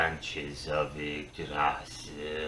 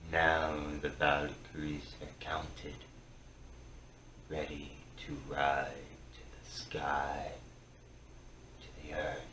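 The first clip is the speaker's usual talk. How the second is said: hushed whisper; 2 semitones lower, 8 dB quieter than usual. Acted. Hushed vision of Valkyries.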